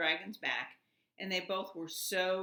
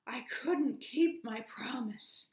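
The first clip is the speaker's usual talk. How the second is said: slow, high, winded and sorry; out of breath; 7 semitones higher, 4 dB louder, slower than usual. A narrator's reading. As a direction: force the words out, exhausted. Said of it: "puffed the dragon".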